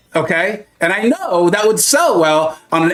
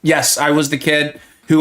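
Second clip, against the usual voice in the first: ordinary talk, nothing marked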